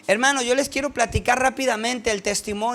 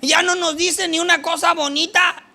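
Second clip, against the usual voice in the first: gossiping women; 5 semitones higher, 4 dB louder than usual. Retold or imitated. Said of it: "Empezaron entre ellas a platicar".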